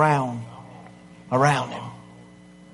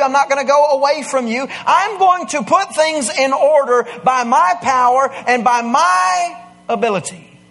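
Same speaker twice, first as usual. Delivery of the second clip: preaching at full force; 7 semitones higher than usual. Acted. Preacher promising the congregation Jesus is present, full-force preaching. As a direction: preach it out, building to the boom.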